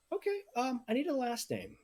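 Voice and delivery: cheery high voice greeting guests at the door; half-elf maiden. Acted. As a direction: lift way up into a chirpy maiden. GM voicing half-elf hostess.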